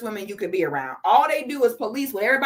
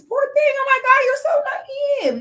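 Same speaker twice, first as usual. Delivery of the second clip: high, loud sing-song mock sympathy; mock pity; 4 dB louder than usual. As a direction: coo it with exaggerated fake pity. Mocking pity for naive viewer.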